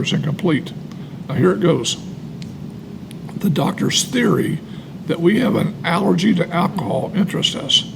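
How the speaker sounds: ordinary talk, nothing marked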